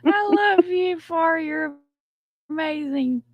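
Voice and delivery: high sweet declaration; gushing fan voice clip; requested voice clip. Shown as a demonstration. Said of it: "here's your voice clips".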